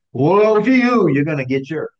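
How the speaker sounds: loud, high, menacing "Woe to you"; threatening woe. Shown as a demonstration. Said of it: "It's not a threat".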